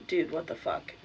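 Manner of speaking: low, soft, disbelieving "what the fuck"; exasperated mutter. Retold or imitated. To the intruder still in her basement, low exasperated disbelief.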